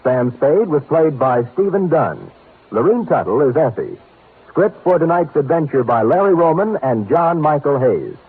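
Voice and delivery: loud, booming credits read; radio announcer closing. Acted. Announcer reads the closing credits to listeners, loud and booming. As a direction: announce it big over the music.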